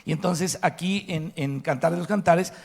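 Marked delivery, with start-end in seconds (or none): none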